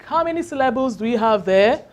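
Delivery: normal talking voice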